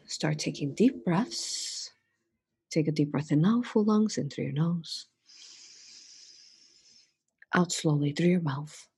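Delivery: soft meditation voice with long pauses; hushed, spacious breathing cues; guided breathing. Real, not acted. Leads breathing exercise.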